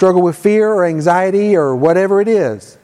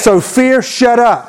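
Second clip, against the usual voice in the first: higher, louder commanding declaration; like you mean it; 6 semitones higher, 3 dB louder, slower than usual. Shown as a demonstration. Preacher banishes worry and fear for church to repeat, loudly commanding. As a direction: banish it in your outside voice. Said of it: "say it like you mean it".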